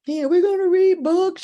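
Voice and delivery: put-on high, loud, gleeful child voice; imitated child's happy shout; excited little boy. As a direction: cheer it like a thrilled kid.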